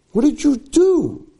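high, drawn-out mock outrage; accusing question. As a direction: wail the accusation with a long last word. Accusation repeated more intensely.

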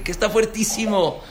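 incredulous rabbi retort. Retold or imitated. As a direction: scoff at the complaint. Rabbi answers the complaint incredulously.

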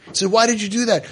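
imitated father's reproach, high-pitched; annoyed. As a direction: challenge him with mild annoyance. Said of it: "My father got very annoyed".

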